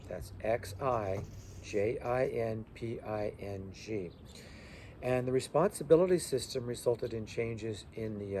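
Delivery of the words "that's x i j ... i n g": XI JINPING letter by letter, slow; spelling out letters. Shown as a demonstration. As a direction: dictate each letter of JINPING to the class. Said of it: "His name was Xi Jinping".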